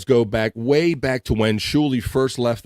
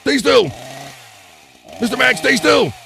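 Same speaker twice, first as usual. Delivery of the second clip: very high, loud, frantic doctor voice; hammed-up high-pitched dermatologist; mock dermatologist; 12 semitones higher, 5 dB louder than usual. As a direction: order a squirming patient around in a high yelp. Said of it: "go see your dermatologist".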